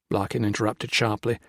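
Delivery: ordinary delivery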